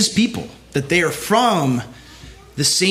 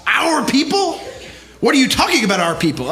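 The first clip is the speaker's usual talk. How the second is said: "people?" jumps much higher in scandalized disbelief; mock outrage; 7 semitones higher than usual. Imitating critics who lose their minds at the phrase, in mock outrage. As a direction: ham up a shocked critic, laughing. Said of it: "People will lose their minds".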